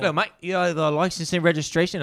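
light Australian cop impression; Australian lady cop. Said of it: "She was an Australian lady cop".

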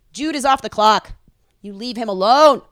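ordinary talk, nothing marked

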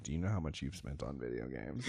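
low, soft mock-stern wife voice; wife's scolding question. Husband imitates his wife asking about game spending, mock stern. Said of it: "she's like".

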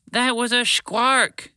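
high, drawn-out yell. A narrator's reading. Spaceship telling Wheezy about the squark, yelling. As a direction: yell it slowly in a high pitch. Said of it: "Spaceship yelled".

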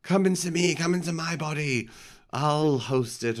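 theatrical high-pitched invitation; imitated pleading priest. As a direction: call the demon into you, hammed up. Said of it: "He just says".

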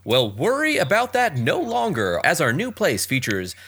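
slow, hammy announcer sell; a hint of a TV ad announcer; cheesy announcer voice. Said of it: "do my best cheesy announcer voice".

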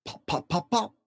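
high popping cork sounds. Acted. Dad reading aloud, high popping cork. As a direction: pop them out high like a cork. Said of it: "He can go like a cork".

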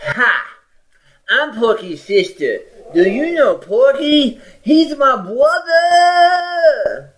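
put-on high childlike girl voice, last words stretched way out; a big little girl introducing herself sweetly; Porky's sister voice. Host imitating a child at a football game, high and slow. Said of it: "And she was like".

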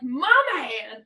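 loud, hearty movie-hero exclamation; imitated Aquaman catchphrase. Imitating Aquaman in the Whedon cut, big and loud. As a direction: belt out the catchphrase. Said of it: "he's all like".

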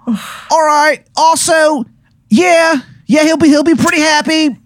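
squeaky-high Baltimore character voice; bad Baltimore accent. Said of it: "this Baltimore accent".

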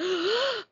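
big theatrical gasp; shocked gasp. Acted. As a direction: gasp like a stunned wedding guest. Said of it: "everyone's like".

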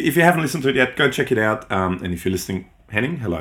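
usual speaking voice

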